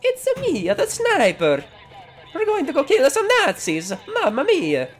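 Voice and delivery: very high, loud cartoon Italian voice; over-the-top mock Italian, high and loud; Mario. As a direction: ham up a squeaky Italian-accented game hero. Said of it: "you don't have to go, like, full Mario with it".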